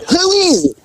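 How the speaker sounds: high, wary voice through the door; suspicious lookout. Retold or imitated. A lookout behind the door asks who knocked, high and wary. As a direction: play the lookout calling out.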